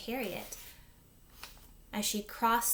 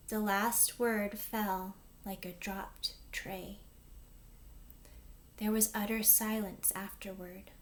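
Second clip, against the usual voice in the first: ordinary delivery, nothing marked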